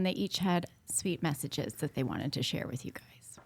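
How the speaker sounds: hushed whisper, no voice. Real, not acted. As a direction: breathe it as a secret. Teasing aside before the messages.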